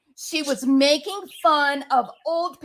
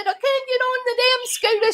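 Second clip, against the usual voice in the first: put-on drunk mom voice; 13 semitones higher than usual. Drunk mom refusing the scooter to her son, high mock squeal. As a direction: squeal it like the laughing drunk mother. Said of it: "She said".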